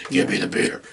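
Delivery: hushed, breathy demon voice; demonic voice. Retold or imitated. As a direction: rasp it like a possessed boy. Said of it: "a demonic voice".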